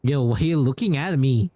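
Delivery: ordinary talk, nothing marked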